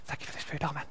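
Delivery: hushed whisper, rushing to get it over; embarrassed whispered prayer. Retold or imitated. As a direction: mumble grace so nobody notices. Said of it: "he wouldn't give thanks like this".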